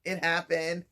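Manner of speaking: ordinary delivery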